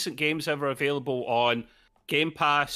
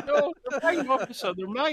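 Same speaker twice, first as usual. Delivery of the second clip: high-pitched, laughing protest of innocence; mock pleading; 10 semitones higher than usual. Gamer caught in an imagined raid pleading with police, mock panic.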